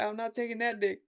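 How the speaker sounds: high, soft refusal; high, soft mock refusal; girl horse voice. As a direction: squeak it like a horse saying no. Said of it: "She was like".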